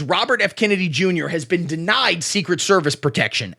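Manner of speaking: usual speaking voice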